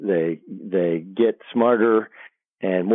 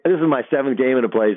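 ordinary talk, nothing marked

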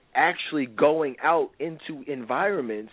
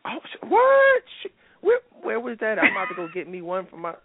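mock shock; 10 semitones higher than usual. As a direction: gasp it out in a shrieking put-on woman's voice. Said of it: "woman shocked by price".

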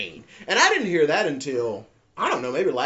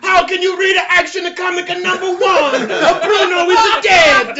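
loud hammed-up crying wail, laughing; mock wail; 9 dB louder than usual. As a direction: bawl it like a cartoon mourner.

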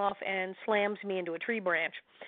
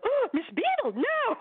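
mock shocked fan. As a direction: play a scandalised fan in disbelief. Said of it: "people do read her book and go".

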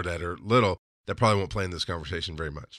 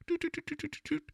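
tiny, high, soft doot-doot-doot; playful doo-doo noise; 12 dB quieter, faster than usual. Pretend. Host showing off his bobblehead, playful little noise. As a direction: imitate a wobbling bobblehead, very softly.

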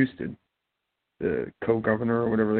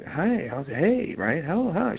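mock cheerful greeting, pitched much higher; lively greeting; 9 semitones higher, slower than usual. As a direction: squeak the hellos up high like an eager friend. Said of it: "They go".

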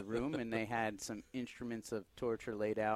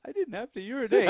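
high, loud comic confession; retold admission, voice jumping up high; mock pained admission; 12 semitones higher, 10 dB louder, faster than usual. Patient to waiting nurse after screaming, mock pained admission.

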